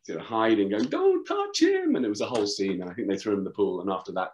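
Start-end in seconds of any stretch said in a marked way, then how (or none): 0.8-2.0 put-on high, slow shriek of a panicked girlfriend; mock panicked girlfriend cry; imitating a frantic girlfriend. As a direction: pitch it way up as if protecting him. Girlfriend voiced in retold scene.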